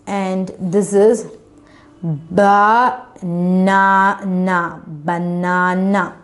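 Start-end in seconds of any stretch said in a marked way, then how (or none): 2.0-6.2 "banana" slowly broken into ba, na, na; syllable division. Shown as a demonstration. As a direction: sound out "banana" syllable by syllable, then join it. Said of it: "We just divided into two syllable".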